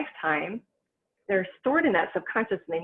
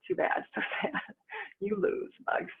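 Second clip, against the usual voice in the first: chuckling through a playful taunt; laughing mock taunt; 10 semitones higher, 5 dB quieter than usual. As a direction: tease the bugs, laughing under your breath.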